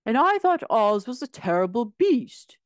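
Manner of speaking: a hint of the indignant Tin Woodman; Tin Woodman's voice. A narrator's reading. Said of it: "said the Tin Woodman".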